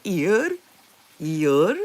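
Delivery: "ear" with no L at all; L left silent. Shown as a demonstration. Showing how they would say it, "ear" without the L. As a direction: glide from long ee straight to r in "ear". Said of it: "They can't say the L".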